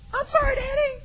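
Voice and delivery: put-on high, small apologetic girl voice; daughter's voice. Daughter apologizing to her father, high meek voice. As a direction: say sorry like a meek child. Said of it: "But there wasn't that".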